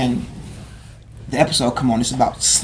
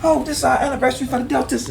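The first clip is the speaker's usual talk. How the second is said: eager booker; 12 semitones higher than usual. Retold or imitated. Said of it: "now they hiring me".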